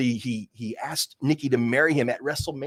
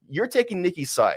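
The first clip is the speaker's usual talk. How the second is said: ordinary delivery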